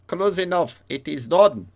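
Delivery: put-on foreign merchant accent, pitched high, in English; mock foreign trader accent closing a sale; Tintin merchant accent. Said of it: "mon accent que j'ai volé dans, dans les aventures de Tintin".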